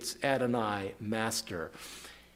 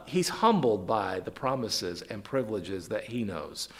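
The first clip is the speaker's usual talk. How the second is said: ordinary talk, nothing marked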